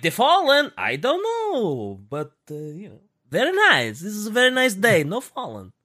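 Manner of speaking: mock Borat accent, high and sing-song; pseudo Borat accent. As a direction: play it as a cheery Borat-style foreigner. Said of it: "they've got a Borat accent now".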